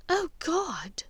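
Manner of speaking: whispered, mortified groan; embarrassed whisper. Acted. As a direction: hide the face and breathe it out. Embarrassed mother hides face.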